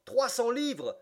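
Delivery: high, loud outburst; shocked cry. A narrator's reading. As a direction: cry out, aghast at the fine. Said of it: "s'écria Passepartout". (cri indigné de stupeur, aigu et fort)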